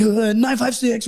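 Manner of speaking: slow, high, effortful digits; strained push-up voice. Retold or imitated. Recruit giving number during push-ups, slow strained voice. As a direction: imitate talking through push-ups. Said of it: "I'm doing push-ups".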